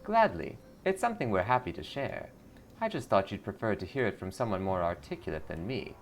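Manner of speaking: sugary cult greeter; lightly voiced sweet cult recruiter. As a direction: welcome her in like a smiling recruiter. Cult recruiter shares creed.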